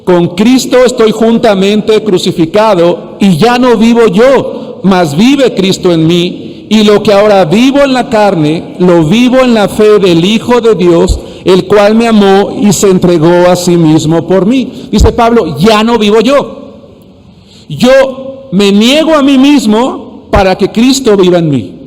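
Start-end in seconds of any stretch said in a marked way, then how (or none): 0.0-14.9 slow, forceful scripture recitation; strong congregational reading. Shown as a demonstration. Preacher has congregation read God's word together, strong and slow. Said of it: "Dice la palabra de Dios fuerte, todos juntos". 15.6-21.7 slow, high declaration of a verse; emphatic preaching. Real, not acted. Preacher restates Paul's words to congregation, slow and emphatic.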